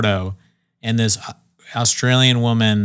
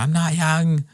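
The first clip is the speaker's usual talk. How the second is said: mock pleading; 6 semitones higher, slower than usual. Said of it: "She started crying".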